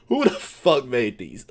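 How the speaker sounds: normal talking voice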